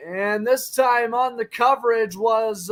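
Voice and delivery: normal talking voice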